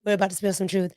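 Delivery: usual speaking voice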